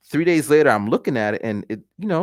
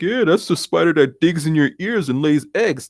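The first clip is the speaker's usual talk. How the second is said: higher, put-on friend voice; mock know-it-all friends, pitched up; teasing friends; 6 semitones higher than usual. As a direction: imitate friends passing on a horrible fact. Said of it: "my friends are all like".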